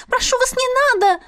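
high, pleading cry of fear; frightened pleading. Acted. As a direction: beg in a high scared voice. Frightened girl pleading with elder.

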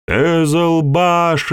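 high, slow alarmed call; shouted warning. A narrator's reading. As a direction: cry out across the field. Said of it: "крикнул кто-то".

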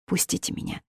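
breathless whispered begging; almost no voice left, beg; whispered plea. Acted. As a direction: daughter begging to be let go, whispered. Hysterical daughter pleading.

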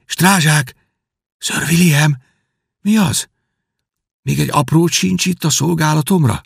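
put-on a boy half-asleep calling for his servant; prince calling.

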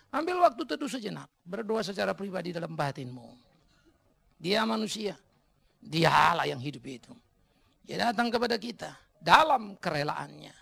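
measured phrases with long pauses and sudden swells; renungan khotbah. Acted. Memimpin renungan teduh. (renungan khidmat, berjeda panjang)

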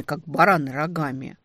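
usual speaking voice

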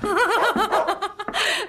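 loud scornful cackle; gloating laugh at the frightened man; mocking laugh. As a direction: laugh in his face.

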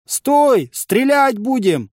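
high, loud, drawn-out shout; shouted warning. A narrator's reading. Archers warn approaching fighters to halt, shouted warning. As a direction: call it across the field. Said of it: "долетел крик от строя".

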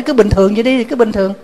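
high, breezy dismissal; husband telling the monk to leave things as they are, offhand; husband's retort. Retold or imitated. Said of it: "Thầy nói".

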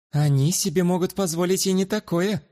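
high, poised lady's voice; haughty noble lady. Acted. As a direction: explain it with aristocratic pride. Luana continues.

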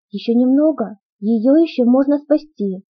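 ordinary delivery, nothing marked

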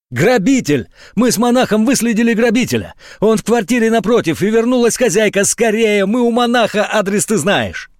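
panicked shouting. A narrator's reading. Dobrodeev reports the burglar to the major, panicked shouting. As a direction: yell the news in a rush.

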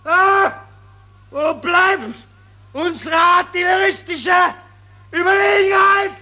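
high, loud, fast barrage of demands; furious shouting. Acted. General screams at his staff about missing guns. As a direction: hammer every question at them.

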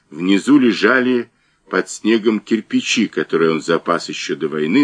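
plain reading voice

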